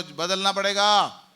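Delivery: loud slow congregational response. Shown as a demonstration. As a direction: call it out firmly and slowly. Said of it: "जोर से बोलो".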